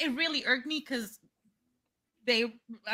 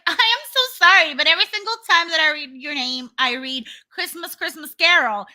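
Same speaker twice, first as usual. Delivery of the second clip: higher, louder gushing apology; mock apology; 5 semitones higher, 6 dB louder than usual. Sister imitates a stranger gushing a high, loud apology about her name. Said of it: "somebody was like".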